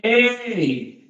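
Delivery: high, drawn-out "hey" to the group; stretched, high-pitched shout of a boy's friend; calling friend. Acted. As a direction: call out to halt your friends. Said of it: "दूसरा दोस्त बोलते हैं".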